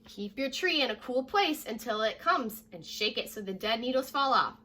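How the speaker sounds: normal talking voice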